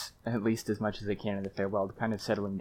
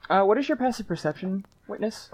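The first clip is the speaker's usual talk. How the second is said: higher, put-on asking voice; questioning; 9 semitones higher than usual. Game master asks Witness in a higher put-on voice. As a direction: ask it like a curious stranger.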